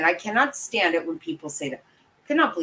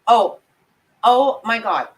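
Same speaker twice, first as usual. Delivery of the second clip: usual speaking voice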